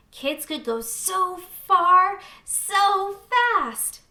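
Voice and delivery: put-on a child reading her letter, carried away by the idea; excited child voice. A narrator's reading. Child in awe of a fast slide, excited.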